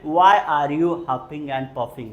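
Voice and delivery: slow, clear question; slow model question. Shown as a demonstration. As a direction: pronounce it slowly for learners. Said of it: "बोलेंगे जरा". (धीमा साफ़ प्रश्न)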